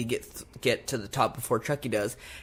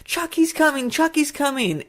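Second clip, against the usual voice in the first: put-on much higher, frantic kid voice; imitated yelling boy; 3 dB louder than usual. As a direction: cry out a warning like a frightened child. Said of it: "he's, like, yelling out of the door".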